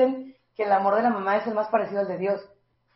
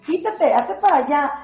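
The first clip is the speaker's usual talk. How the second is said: raised, higher scolding parent; mock parental shouting; 5 semitones higher, 4 dB louder than usual. Parent yelling at a child to move away, mock shouting.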